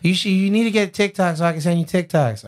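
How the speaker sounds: high-pitched mock partner voice; partner impression. Acts out partner urging him.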